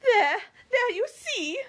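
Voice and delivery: high, loud, triumphant delight; ecstatic cry. Acted. Mary triumphant at the waiter's news, ecstatic cry. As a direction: cry it out, thrilled to be right. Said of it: "cried Mary in ecstasy".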